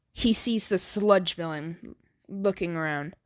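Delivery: plain reading voice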